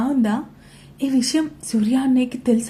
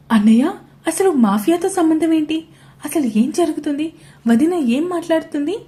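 anxious questioning; 6 semitones higher, 4 dB louder than usual. A narrator's reading. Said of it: "ప్రశ్నిస్తూ అడిగింది అక్షర".

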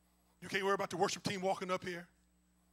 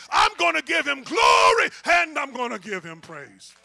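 loud, rising gospel preaching; preaching at full force; 4 semitones higher, 15 dB louder, slower than usual. Real, not acted. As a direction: build the fire and preach it out.

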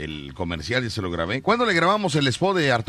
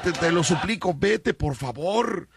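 higher-pitched, comic begging; mock pleading; 6 semitones higher than usual. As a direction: plead desperately, as if hiding it from the in-laws. Begging toilet to go, in-laws outside.

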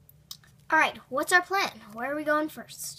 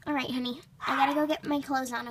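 ordinary talk

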